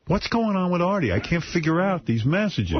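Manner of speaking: a touch of bewildered panic; retold confused question. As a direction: play it baffled and lost. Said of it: "I go".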